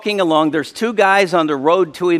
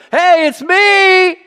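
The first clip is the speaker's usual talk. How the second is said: mock shout; 5 dB louder, much slower than usual. Jesus imagined bursting in.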